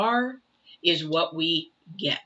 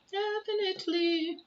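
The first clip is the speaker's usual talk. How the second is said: squeaky definitely; 14 semitones higher than usual. Pretend. Reader to viewers, a teasing high-pitched definitely. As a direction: chirp it up high and playful.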